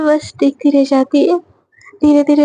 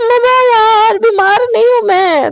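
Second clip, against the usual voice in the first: whiny protest; 7 semitones higher than usual. Acted. Daughter protesting to her mother.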